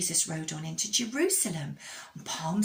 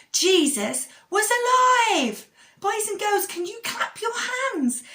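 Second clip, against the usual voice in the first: much higher, louder, slowed joyful call; excited; 9 dB louder, slower than usual. Real, not acted. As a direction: burst out with the good news. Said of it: "We're so excited this morning".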